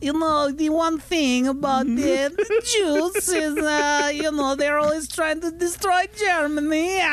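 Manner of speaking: put-on high slow Borat-style accent. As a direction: ham up a Borat Hitler. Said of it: "Borat Hitler would be good".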